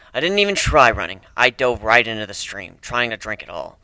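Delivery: plain reading voice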